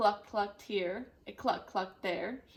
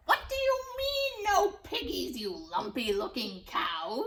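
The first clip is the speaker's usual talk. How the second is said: screaming witch; 2 semitones higher, 3 dB louder than usual. Acted. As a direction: scream at the cow. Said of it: "screamed Gritch".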